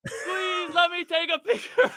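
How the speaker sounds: high, laughing shouted plea; kid shouting. Retold or imitated. Storyteller imitating a kid chasing Shaq, high laughing shout. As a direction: call out after him like an eager kid. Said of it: "some kid is running after him".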